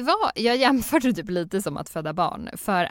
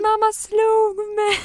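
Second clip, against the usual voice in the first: put-on slow, much higher, louder child wail; wailing toddler; 7 dB louder, much slower than usual. Toddler loudly accusing mother in public, high slow wail. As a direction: stretch it out like a kid making a scene.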